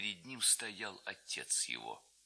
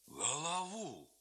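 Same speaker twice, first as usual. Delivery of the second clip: surprised question; 6 semitones higher, much slower than usual. A narrator's reading. Lad asks in surprise.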